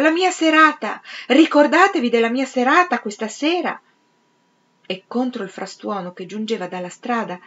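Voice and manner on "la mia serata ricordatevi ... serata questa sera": high, loud, urgent reminder; crying out. A narrator's reading. Clarissa calls after Peter from the landing, shouting. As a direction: shout it after someone who is leaving. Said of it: "gridò Clarissa correndogli dietro sul pianerottolo".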